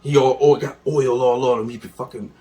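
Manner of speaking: ordinary delivery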